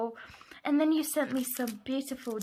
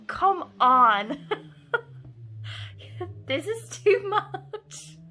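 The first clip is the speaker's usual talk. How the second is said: high, loud laughing protest; overwhelmed laughter; 6 semitones higher, 6 dB louder, slower than usual. Real, not acted. Crafter protesting friend's generosity, laughing and overwhelmed. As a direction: protest helplessly through laughter.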